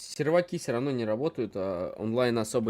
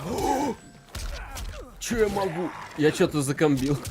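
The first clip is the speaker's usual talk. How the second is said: excited; 10 semitones higher than usual. Real, not acted. Surprised by own combo in game.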